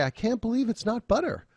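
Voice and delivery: high-pitched, pleasantly surprised gush; delighted amazement. Shown as a demonstration. Lecturer voices someone loving the butter substitute, delighted amazement. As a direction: say it amazed at how good it tastes. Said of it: "Hmm, it's so good".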